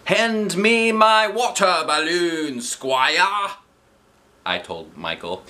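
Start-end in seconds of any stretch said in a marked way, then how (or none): 0.0-3.5 high, slow grand knight voice; mock-royal command to a squire; playful knight. Kids playing knights, narrated quote.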